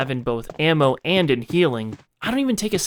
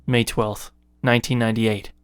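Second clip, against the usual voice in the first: measured, grim voice reading a diary; 4 semitones lower than usual. Acted. Player reading a keeper's diary aloud, low and grim. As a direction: read the diary entries like a worn-out keeper.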